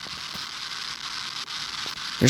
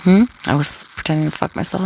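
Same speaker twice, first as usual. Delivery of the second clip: normal talking voice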